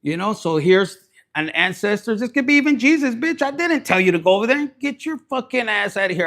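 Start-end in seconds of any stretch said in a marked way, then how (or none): 2.2-6.3 fast, rising heated rant. Real, not acted. Reader ranting at meddlers through an ancestor, fast and heated. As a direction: rattle it off fired up.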